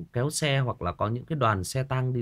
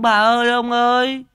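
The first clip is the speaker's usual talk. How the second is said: high, loud pretend funeral crying; copycat mock wail; 11 semitones higher, 8 dB louder than usual. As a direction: wail along louder, faking grief. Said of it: "thì mình cũng". (khóc hùa theo, giả vờ gào to)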